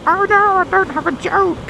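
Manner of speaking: hammed-up high whine of dismay; mock dismay. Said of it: "not gonna have my usual".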